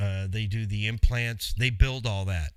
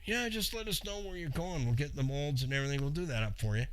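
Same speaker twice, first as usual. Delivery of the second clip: friendly lab clerk; 2 semitones higher, 6 dB quieter, faster than usual. Pretend. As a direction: play the cheerful receptionist on the phone. Voicing the lab staff.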